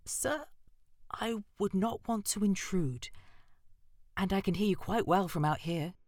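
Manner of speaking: nervously decline, hoping not to offend; timid. A narrator's reading. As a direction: mouse timidly refusing to enter the owl's house. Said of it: "she said timidly".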